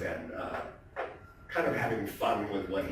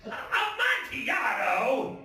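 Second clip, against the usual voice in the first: laughing Fortunato; 13 semitones higher, much slower than usual. Acted.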